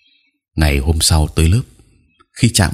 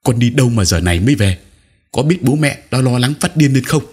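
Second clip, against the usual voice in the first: higher, louder scolding mother; worried mother scolding her child; snapping scold; 6 semitones higher, 3 dB louder than usual. A narrator's reading. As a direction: snap at the child for coming home so late. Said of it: "Bà gắt".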